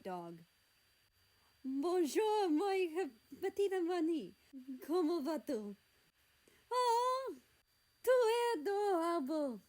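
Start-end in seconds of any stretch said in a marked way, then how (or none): 1.6-9.6 high, loud French gushing; exclaiming. A narrator's reading. France greets the dog, exclaiming in excited French. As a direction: coo loudly in French over a cute animal. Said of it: "she exclaimed".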